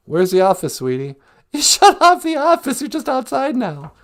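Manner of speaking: giggling banter; laughing tease. Real, not acted. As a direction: tease the partner mid-laugh. Laughing, teasing partner.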